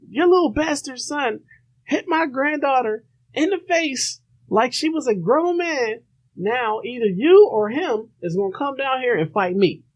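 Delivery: high mock old-lady rant; angry grandma. Grandma challenging the mom to fight, high mock rant. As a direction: pitch way up, huffy grandma. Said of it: "Chick's grandma was like".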